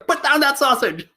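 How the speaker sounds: high, comic scolding order; high-pitched mock outrage; mock command. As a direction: call out a playful telling-off. Mock command to Whitman in picture.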